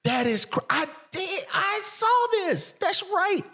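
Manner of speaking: high, excited exclamations; thrilled by the reveal. Real, not acted. Guest reacting to the [name] story, high and excited.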